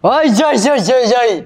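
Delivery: high, loud cries of pain; pained yelp. Real, not acted. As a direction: yelp it out, stung. Reacting to a harsh taste.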